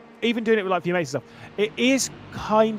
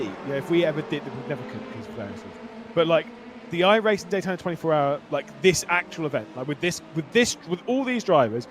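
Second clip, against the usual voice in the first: ordinary talk, nothing marked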